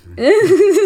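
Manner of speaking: high, tearful burst of laughter; laughing through tears. Real, not acted. Daughter laughs through tears at father's story, tearful laugh.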